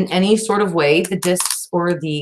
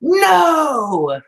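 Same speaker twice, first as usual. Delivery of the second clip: drawn-out high cry of no; long swooping retold shout; alarmed protest; 9 semitones higher, 3 dB louder than usual. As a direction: wail it high, letting it slide down. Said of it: "I'm like".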